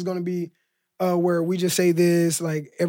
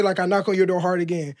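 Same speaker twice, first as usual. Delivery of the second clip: ordinary talk, nothing marked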